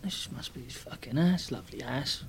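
quietly impressed under the breath; muttered aside. Retold or imitated. Arriving at the manager's big house, quiet muttered awe. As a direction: mutter it to yourself, awed.

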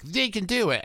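high, peppy cheering host; upbeat cheer. Pretend. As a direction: cheer the team on. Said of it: "You need the upbeat".

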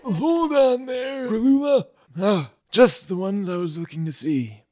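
put-on old Grocky.

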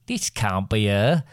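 slow, harsh creature voice. A narrator's reading. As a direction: rasp it out slowly as a harsh stranger. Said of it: "said in a harsh voice".

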